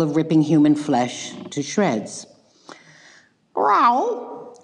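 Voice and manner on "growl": high little cub growl; a tiny squeaky growl, much higher; baby bear growl. Acted. Baby bear growling at Goldilocks, high little growl. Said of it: "growled the baby bear".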